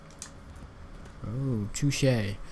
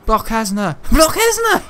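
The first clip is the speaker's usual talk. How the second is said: high loud urgent yell; excited shout; 7 semitones higher, 6 dB louder than usual. Real, not acted. Gamer yelling at character mid-fight, excited shout. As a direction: yell it at the screen.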